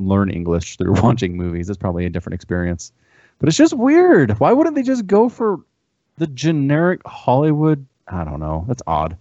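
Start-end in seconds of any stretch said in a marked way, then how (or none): 3.4-7.9 baffled. Real, not acted. Puzzled rant.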